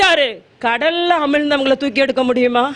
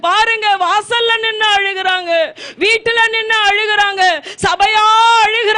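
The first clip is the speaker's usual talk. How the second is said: higher, shouting sermon cry; preaching at full force; 5 semitones higher than usual. Acted. Preacher shouting to the congregation about the weeping people. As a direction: drive it home to the church, shouting.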